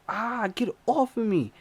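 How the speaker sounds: high, pained get off; panicked plea. Retold or imitated. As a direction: cry out to be let go. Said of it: "I'm like".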